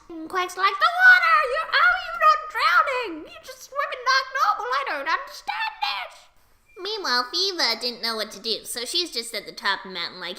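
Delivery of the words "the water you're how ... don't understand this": high, half-laughing mock panic; hammed-up panic. Queg thinks he's underwater, high mock panic. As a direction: cry out high in exaggerated bewilderment.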